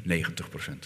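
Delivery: ordinary talk, nothing marked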